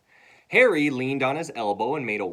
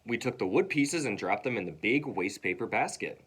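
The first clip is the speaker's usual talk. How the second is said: usual speaking voice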